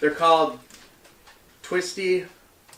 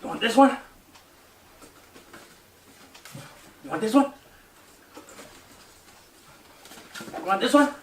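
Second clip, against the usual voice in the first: higher coaxing pet voice; 7 semitones higher, faster than usual. Real, not acted. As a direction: offer it sweetly to a pet.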